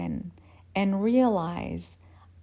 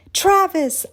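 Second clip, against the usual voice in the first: high, loud exclamation; sudden pitched-up outburst; excited recognition; 11 semitones higher, 9 dB louder than usual. Acted. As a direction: call out the name as it comes back. Excited shout of remembered name.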